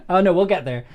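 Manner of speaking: ordinary talk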